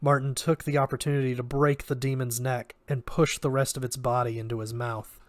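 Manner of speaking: ordinary delivery, nothing marked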